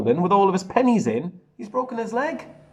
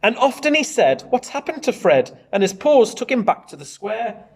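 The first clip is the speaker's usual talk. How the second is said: put-on lively read-aloud to children; bouncy storybook narration; story reading; 4 semitones higher, 5 dB louder than usual. A narrator's reading. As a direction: read it out like a picture book for the class. Teacher reads picture book aloud.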